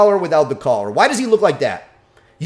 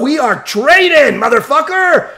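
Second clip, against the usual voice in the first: loud, high, slow hype; pumped-up shout; 9 semitones higher, 5 dB louder than usual. Shown as a demonstration. Trainer hyping his dog, loud and high. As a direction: fire the dog up. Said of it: "I train with my dog".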